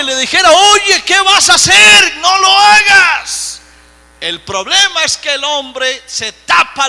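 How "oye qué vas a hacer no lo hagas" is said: loud, high-pitched cry of warning; urgent warning shout. Acted. God stopping a man about to sin, acted warning shout. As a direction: call out to stop someone, last words drawn out.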